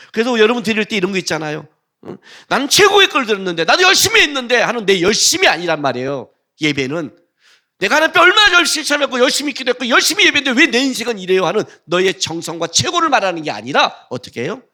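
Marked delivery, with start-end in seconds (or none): 2.5-4.6 high, loud self-pitying protest; whiny complainer. Retold or imitated. Mimicking a complaining believer. 7.8-11.5 high, loud, fast whine; fast complaining worshipper. Retold or imitated.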